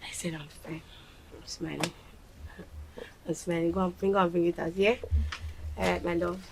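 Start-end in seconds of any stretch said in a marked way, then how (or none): 0.0-1.9 whispered insistence. Acted. Mother hushing herself to the child.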